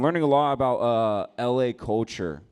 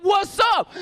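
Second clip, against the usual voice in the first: much higher, louder hammed-up yell; mock shout; 10 dB louder than usual. Jumping into the fight, much higher hammed-up yell. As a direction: bellow it, joining in.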